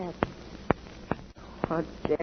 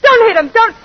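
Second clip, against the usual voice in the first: screaming, much higher and louder, don't hit him; panicked plea; 8 semitones higher, 11 dB louder than usual. Acted. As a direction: scream to stop the punch. Begs Danny not to hit.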